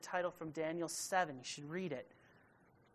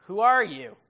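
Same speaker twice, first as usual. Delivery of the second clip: loud challenge; 4 semitones higher, 15 dB louder than usual. Acted.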